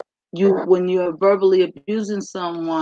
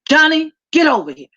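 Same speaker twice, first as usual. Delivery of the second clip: put-on sharp, fast, much higher yell of a child's name; imitating a parent's yell; 9 semitones higher, 5 dB louder, much faster than usual. Fed-up parent yelling for Johnny to come over: loud impatient shout. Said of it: "the parent decides to yell at the child".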